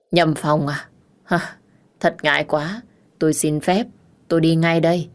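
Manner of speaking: usual speaking voice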